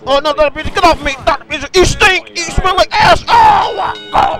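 high, loud shouts at an attacker; shouted insults rising into a wail; angry protest. Pretend. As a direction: yell in hammed-up disgust to get him off. Fighting off Doctor Pizza in play.

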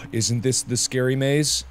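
ordinary delivery

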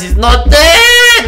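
high, loud, drawn-out wail; real gamer rage cry; outraged disbelief. Real, not acted. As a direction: stretch it into an exasperated yell. Enemy survives two headshots.

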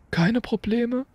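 a crisp teacher's question; McGonagall. A narrator's reading. McGonagall checking on Hagrid, crisp and stern.

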